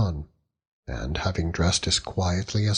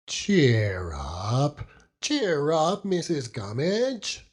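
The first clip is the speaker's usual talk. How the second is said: put-on coaxing old fisherman; 6 semitones higher, much slower than usual. Peggotty coaxing gloomy Mrs Gummidge.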